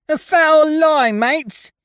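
very high, loud, drawn-out screech. A narrator's reading. Young Dickon denies the murder to his mates, screeching. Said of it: "screeched young Dickon".

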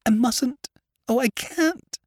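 very high, strangled distress; choking cry. A narrator's reading. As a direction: choke out the refusal in anguish. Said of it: "cried in a choking voice".

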